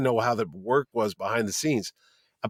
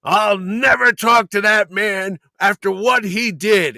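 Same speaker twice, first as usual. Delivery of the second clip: put-on slow, raised, bitter old grudge; bitter refusal; 7 semitones higher, 5 dB louder, slower than usual. Old comic writer refusing to share a panel with his rival, bitter. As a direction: spit out each word of the grudge.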